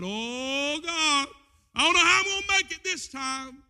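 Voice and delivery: building wail of despair; mock anguished cry. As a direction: call out to God in despair. Voices a despairing believer crying out.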